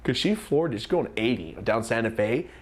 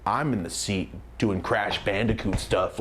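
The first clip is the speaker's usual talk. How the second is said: ordinary delivery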